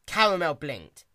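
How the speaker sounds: plain reading voice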